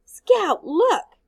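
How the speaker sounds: high, loud calling out; excited call. Acted. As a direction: call out to point. Child calling out at fire.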